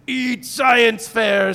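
slow, loud, distorted monster roar; grotesque monster voice. Acted. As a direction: drag it out like a hungry goo monster. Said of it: "said the goo monster".

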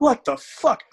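shocked outburst. Retold or imitated. Realising the huge guy was in high school, shocked outburst. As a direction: blurt it out in total disbelief.

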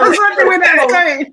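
high, fast and loud with laughter; laughing. Real, not acted. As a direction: blurt it out through a laugh. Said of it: "laughing at the broken jingle".

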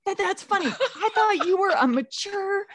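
high, heated mock scolding mom; high-pitched Mama Bear scolding the laughing friend. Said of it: "here comes Mama Bear Swenson".

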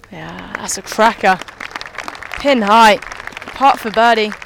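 sudden loud burst of excitement; excited golf commentator. Real, not acted. Shot lands near the pin.

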